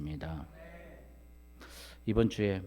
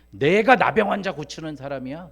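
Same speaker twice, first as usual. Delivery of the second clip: angry outburst, raised and loud; indignant king; 6 semitones higher, 13 dB louder than usual. Acted. Preacher voicing Israel's king asked to heal a leper, indignant. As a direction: protest hotly, acting out an offended king. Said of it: "그렇게 화나는 거예요".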